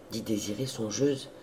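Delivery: ordinary delivery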